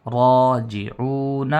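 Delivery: drawn-out راجعون built from syllables; teacher's slow drill; slow spelling drill. Shown as a demonstration. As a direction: spell it slowly then say it whole. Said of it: "یہ اس طریقے سے ہے".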